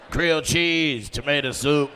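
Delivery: loud, drawn-out order call; mock counter-worker announcement. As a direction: holler food orders across the counter. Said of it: "You call out the orders?".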